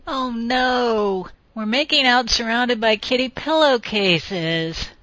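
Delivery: a tiny panicked voice in her head crying out; tiny wailing voice. A narrator's reading. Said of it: "a tiny voice wailed".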